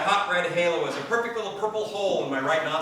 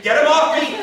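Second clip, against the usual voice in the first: louder, higher yell of the repeat; screaming; 9 semitones higher, 10 dB louder than usual. Retold or imitated. Man screaming as the bird bites his nose. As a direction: yell it out in panic with a laugh. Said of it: "All I can do is scream".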